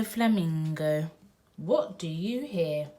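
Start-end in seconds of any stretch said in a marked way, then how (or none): none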